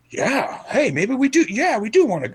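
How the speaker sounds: high, eager mock excitement; hammed-up excited customers; excited diners. Diners deciding on Cheesecake Factory, mock excited. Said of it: "People are like".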